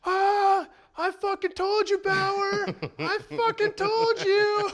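very high, loud heckler's shout; loud high-pitched taunting, slowing at the end; gloating fan yell. Retold or imitated. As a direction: crow it at the pitcher like a rowdy fan.

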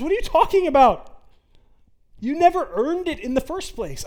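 God brushing off the worry with a loud high retort; God to his stumbling child, loud, high and incredulous. Acted. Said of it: "And he says".

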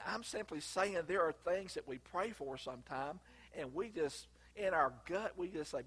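ordinary talk

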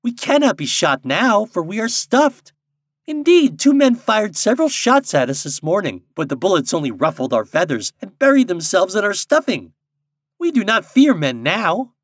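high, loud boastful bird voice; defiant blue jay. A narrator's reading. Blue jay dismissing Popopo's warning, defiantly.

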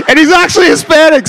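loud, high, laughing through it; laughing punchline. Real, not acted. Preacher explaining the joke while still laughing loudly. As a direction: shout it out mid-laugh.

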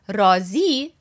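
رازی with the weight on its last syllable, raa-ZI; stress on second syllable. Shown as a demonstration. Teacher contrasting the word for satisfied, second-syllable stress on رازی. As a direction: lean on the final "zi" of رازی. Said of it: "If you put the emphasis on the second word".

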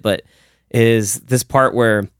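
usual speaking voice

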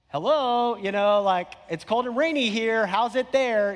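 lightly mock-cheery sarcasm; kinda sarcastic. Pastor imitating his teasing phone greeting, lightly sarcastic. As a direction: tease him a little, fake bright. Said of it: "You know, kinda sarcastic".

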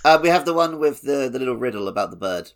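normal talking voice

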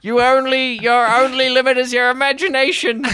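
high, loud, fast mock-inspiring; mock inspirational slogan. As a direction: shout a poster slogan. Mock motivational slogan, laughing.

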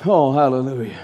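normal talking voice

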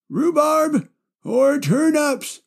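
put-on slow, high old man pondering; grandpa voice. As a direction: drag it out like a dithering grandpa. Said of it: "I just love doing my grandpa voice".

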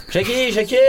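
put-on high call after a child. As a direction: call his name as you chase. Calling son during the chase.

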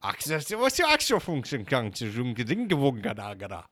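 nonsense mock-Swedish babble; fake Swedish gibberish. Disguised candidate answers the host's fake Austrian in mock Swedish. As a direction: bluff your way through pretend Swedish. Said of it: "that was my Swedish".

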